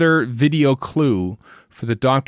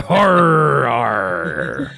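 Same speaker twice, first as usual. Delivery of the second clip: laughing pirate growl; a playful pirate arr; 4 dB louder than usual. Pretend. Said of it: "Talk Like a Pirate Day".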